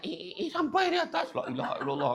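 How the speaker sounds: put-on very high, strained old man's voice. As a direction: puff it out like an old man reaching the top. Imitating old Turkish man climbing. (suara pakcik tua Turki tercungap sampai atas)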